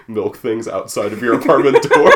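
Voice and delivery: breaking into loud laughter; laughing. Real, not acted. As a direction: laugh through the end of it. Laughing at the prank idea.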